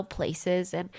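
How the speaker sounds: ordinary delivery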